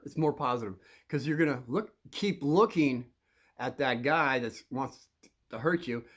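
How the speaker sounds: ordinary talk, nothing marked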